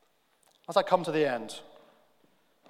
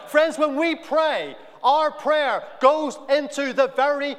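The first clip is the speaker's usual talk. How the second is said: loud, higher-pitched preaching that climbs into shouts; preaching at full force; 6 semitones higher, 5 dB louder than usual. Acted.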